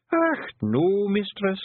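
high, slow Scottish pixie voice, untroubled; a wee Scots fighter shrugging off death; Scots pixie warrior. A narrator's reading. Rob Anybody shrugging off death to Tiffany, high Scots pixie voice.

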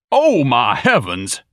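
loud, high, drawn-out exclamation; shouted outcry. A narrator's reading. Ignatius shouting from the parlor, loud and indignant. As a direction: cry out in outrage, stretching the words. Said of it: "a voice shouted from the parlor".

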